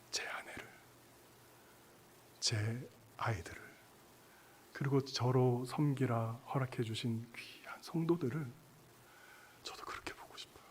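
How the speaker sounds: soft, near-whispered sincerity; quiet and reverent, sinking toward a whisper; hushed resolve. Real, not acted. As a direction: drop to a hushed, heartfelt confession. Tender personal resolve in sermon.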